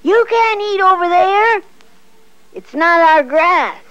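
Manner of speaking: loud protest. A narrator's reading. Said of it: "said Peter".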